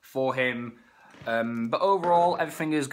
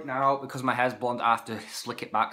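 usual speaking voice

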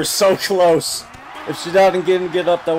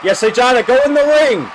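loud, much higher urging yell; excited shout; 9 semitones higher, 8 dB louder than usual. Real, not acted. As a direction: cheer and push her into the ring. Urging wrestler at the screen.

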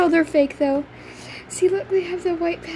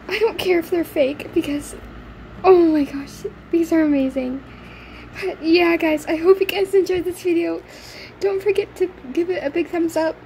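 ordinary delivery

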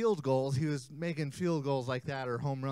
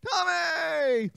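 high called name; 12 semitones higher, 5 dB louder than usual. Shown as a demonstration. Cueing kids to shout name.

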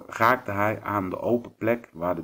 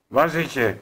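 higher, snarling demand; snarled call; 5 semitones higher, 4 dB louder than usual. A narrator's reading. Werewolf hunting the hidden voice, snarled call. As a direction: snap it out like a wolf. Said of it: "riep hij met een grauw".

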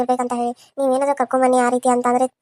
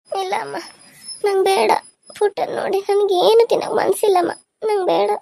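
high, crying protest; tearful refusal; 5 semitones higher than usual. Acted. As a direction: sob out a refusal to your mother. Upset mother refuses food again. (ಅಳುತ್ತಾ ಬೇಡವೆನ್ನುವುದು)

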